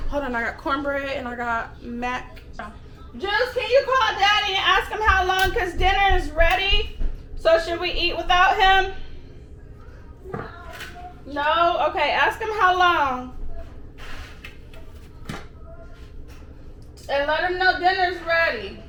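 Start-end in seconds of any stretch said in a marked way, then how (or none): 3.2-7.0 calling out. Real, not acted. Calling out to child. 7.3-18.8 calling out. Real, not acted. Calling out to child.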